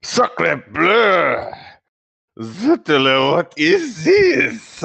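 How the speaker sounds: excited, high French exclamation; French accent. Acted.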